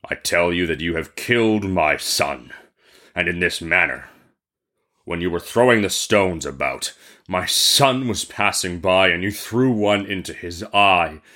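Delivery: low, grave genie voice; reasoning genie. Acted. Genie reasons with the merchant, low grave voice. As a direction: lay out the verdict, pitched well down. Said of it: "reasoned the genie".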